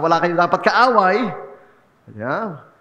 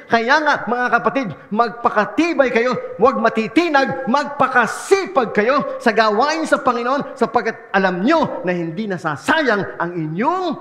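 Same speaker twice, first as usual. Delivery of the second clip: raised, emphatic Bible reading to the flock; scripture reading; 3 semitones higher than usual. Acted. Pastor reading scripture to his congregation, earnest and raised. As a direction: read the verse out like a preacher urging them on. Pastor reads First Corinthians aloud.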